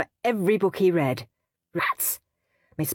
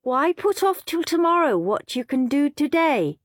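higher, preachy goody-goody voice; smug little brother; 9 semitones higher than usual. A narrator's reading. Peter lecturing Henry about reading sooner, smug. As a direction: lecture him sweetly.

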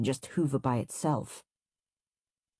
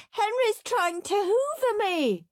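wailing. A narrator's reading. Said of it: "wailed Peter".

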